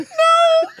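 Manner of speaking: very high, loud squeal; crying-laughing refusal. Retold or imitated. Imitating the driver crying laughing, high squeal. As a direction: squeal it helplessly, imitating a friend in hysterics. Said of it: "he's crying, laughing, crying".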